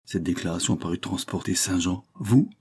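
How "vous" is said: higher, louder startled question; shocked exclamation. A narrator's reading. Servant astonished that she would see the master, shocked cry. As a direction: cry out in disbelief, pitch leaping up. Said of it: "s'écria-t-il".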